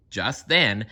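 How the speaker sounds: ordinary delivery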